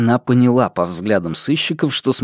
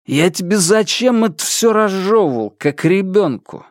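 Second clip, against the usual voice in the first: higher, drawling, stoned sarcasm; Алик, mocking brother; 8 semitones higher than usual. A narrator's reading. Stoned brother scolds Алла on the phone, drawling mockery.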